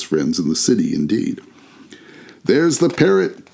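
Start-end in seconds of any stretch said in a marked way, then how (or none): 2.4-3.5 high, delighted exclamation; excited cry. A narrator's reading. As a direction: cry out on spotting an old favourite. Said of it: "cried Scrooge".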